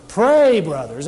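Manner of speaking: big emphatic "Pray" leading the phrase; stress on Pray. Acted. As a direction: lean hard on "Pray", ease off after. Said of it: "to put emphasis on the verb".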